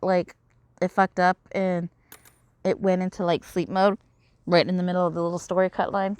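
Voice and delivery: ordinary delivery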